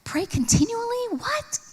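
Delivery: incredulous. Retold or imitated. Stressed mom doubting the verse, high and incredulous. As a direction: toss the command back as if impossible. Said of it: "it kinda sounded impossible".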